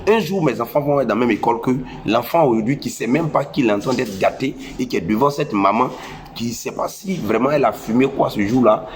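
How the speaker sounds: ordinary delivery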